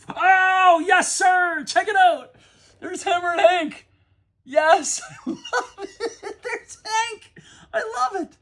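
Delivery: screaming with joy, high and loud, laughing through it; real ecstatic shriek of a collector; fired up. Real, not acted. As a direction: lose it completely over Hammer and Hank. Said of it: "I'm pretty fired up".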